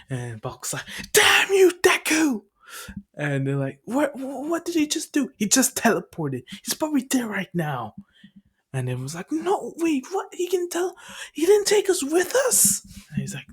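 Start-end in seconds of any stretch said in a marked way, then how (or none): 1.1-2.4 high, loud acted outburst; imitated high-pitched angry shout; angry yell. Acting out Bakugo's outburst. 3.9-7.9 high-pitched baffled exclaiming, imitated; acted-out group astonishment; shocked reaction. 9.3-12.8 very high-pitched protest, imitated; acted-out indignant group; outraged disbelief. Acting out the left-behind group.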